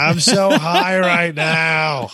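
slow, high, dreamy stoner voice. Pretend. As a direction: drawl it out, totally baked. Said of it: "he's just going".

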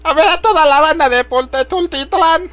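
high put-on showgirl voice; stripper voice. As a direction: call out like a club hostess. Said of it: "voz de puta". (voz de teibolera)

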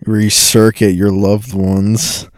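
slow mock commercial voice; deadpan slow announcer; mock ad read. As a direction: sell it like a cheesy infomercial.

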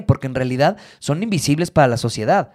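ordinary talk

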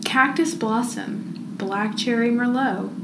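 usual speaking voice

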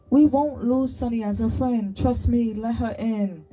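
high, urgent persuasion; insistent arguing. A narrator's reading. Chi-Chi pleading Sunny's case to her friend, insistent.

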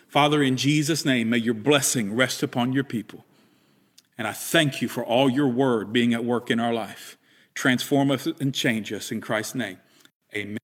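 soft, low blessing prayer; a quiet benediction to close the service; closing prayer. Acted. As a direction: pray over the people gently.

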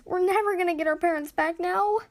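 high-pitched despairing wail; whiny, high lament. A narrator's reading. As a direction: wail as if all is lost. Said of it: "Ash wailed".

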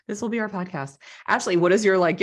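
usual speaking voice

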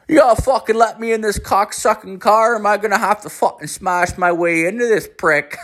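put-on high, demanding Newfoundland accent; bully your way in with a hammed-up Newfoundland twang; high-pitched impression of a cursing Newfoundlander; [name]'s Newfoundland twang. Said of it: "like, this weird twang".

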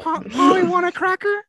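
high squawky parrot voice, loud. Pretend. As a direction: squawk it like a talking bird. Imitating a parrot.

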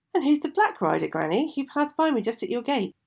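put-on high, eager young girl; curious young girl. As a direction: ask wide-eyed like a child.

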